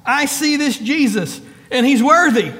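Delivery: ordinary delivery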